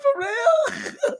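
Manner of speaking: very high squeal breaking into laughter; mock dismay. Storyteller imitating Joe thwarted by Al, laughing mock dismay. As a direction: squeak it out, imitating his disbelief through laughs.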